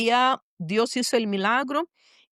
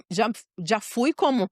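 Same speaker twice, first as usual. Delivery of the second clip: ordinary talk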